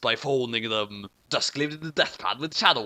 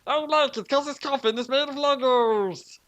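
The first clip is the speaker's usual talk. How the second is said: put-on whiny kid; 10 semitones higher than usual. A narrator's reading. Kid character complains.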